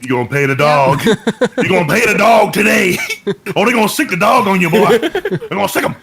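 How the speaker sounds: hammed-up folksy threat, rising in pitch; mock hollering character. Joking to bandmates about the dog, hammed-up hollering. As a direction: holler it like a cartoon heckler.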